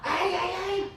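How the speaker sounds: high, hammed-up wail; dying bacterium. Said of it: "yo voy y me muero".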